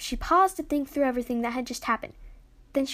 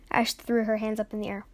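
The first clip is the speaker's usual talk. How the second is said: plain reading voice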